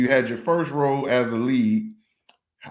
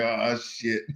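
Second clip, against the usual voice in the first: inner panic laughing; 2 semitones lower, 6 dB quieter than usual. Retold or imitated. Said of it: "on the inside, I'm like".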